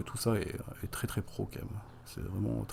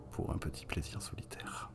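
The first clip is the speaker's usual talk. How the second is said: whisper; 3 semitones lower, 7 dB quieter than usual. Acted. Naughty aside about sandpaper.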